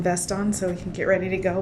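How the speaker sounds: ordinary delivery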